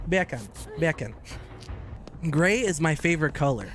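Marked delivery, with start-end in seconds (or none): 0.1-0.4 "Beer can" in a British accent, no r; British "Beer can", sounding almost like bacon. Shown as a demonstration. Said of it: "Say beer can in a British accent". 0.8-1.1 "Beer can" in a British accent, no r; British "Beer can", sounding almost like bacon. Shown as a demonstration. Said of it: "Say beer can in a British accent".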